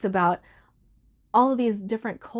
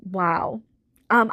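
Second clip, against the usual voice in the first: usual speaking voice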